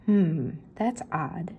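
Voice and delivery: slow, musing hmm; a slow, curious thinking-aloud; puzzled Velma. Acted. Velma finding the card, slow and puzzled.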